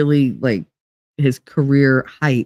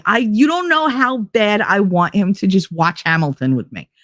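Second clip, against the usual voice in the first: normal talking voice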